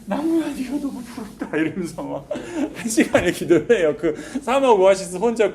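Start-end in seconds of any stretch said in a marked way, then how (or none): 0.0-1.5 high, wailing envy; tearful plea. Retold or imitated. 나무를 붙잡고 우는 남자, 높은 울음. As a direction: cry out to the tree. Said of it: "나무를 붙잡고".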